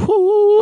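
high, loud joyful whoop. Real, not acted. Hyped up about the food he ate, loud whoop. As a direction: let out a big celebratory woo.

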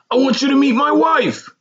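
high-pitched, loud, thrilled introduction; excited. A narrator's reading. Man introducing wife to old schoolmate, excitedly. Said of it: "he said excitedly".

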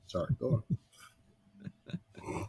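whispered aside. Real, not acted. Quiet aside after burning himself.

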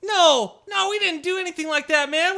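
high, loud protest; agitated denial. Acted. Trapped traveller denying druid's accusation, agitated. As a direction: deny it urgently. Accused character denies the charge.

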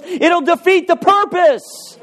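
high, slow patient answer; voice of God. Retold or imitated. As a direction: say it slowly as God correcting. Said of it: "And God goes".

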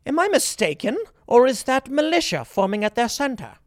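high, snooty British officer sneer; snide snooty Brit. Retold or imitated. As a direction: look down your nose at the rabble. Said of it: "All snide and snooty".

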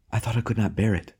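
ordinary delivery, nothing marked

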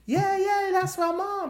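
very high put-on kid voice; imagined boy's voice. Podcaster imagining the boy at his mama's door, high mimicking voice. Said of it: "he would've been like".

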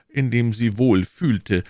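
ordinary delivery

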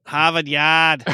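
slow, drawn-out mock Boston accent. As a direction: stretch it like a Boston local. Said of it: "How do they talk in Boston?".

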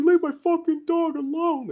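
high strained cry for his dog, retold; mock desperate yell. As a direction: squeal it out like a panicked dog owner. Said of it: "He's like".

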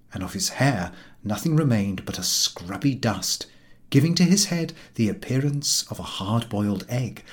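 ordinary delivery

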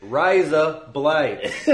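"Rise up lights" loud, high, very drawn out; as slow as possible. Shown as a demonstration. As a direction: stretch "Rise up lights" slowly, nearly laughing. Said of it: "I'm trying to say it as slow as possible".

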